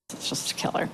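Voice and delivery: near-whispered, low-volume aside; hushed aside. Real, not acted. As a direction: drop to almost a whisper.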